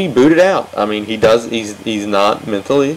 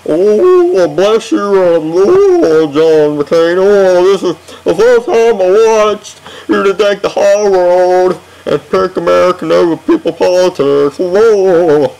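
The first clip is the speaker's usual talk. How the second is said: high, loud, breathy-free gushing [name] voice, much higher; [name] impersonation; 7 semitones higher, 4 dB louder than usual. Retold or imitated. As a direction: gush over him like [name], pitched way up and louder. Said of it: "love my [name] impersonation".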